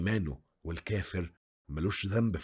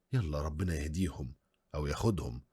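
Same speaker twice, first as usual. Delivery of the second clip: ordinary delivery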